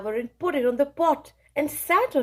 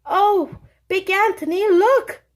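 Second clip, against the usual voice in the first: high, louder startled cry; alarmed surprise; 8 semitones higher, 5 dB louder than usual. Acted. A convent sister warns Big Anthony, startled high cry. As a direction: point and cry out in shock. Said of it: "a sister from the convent said".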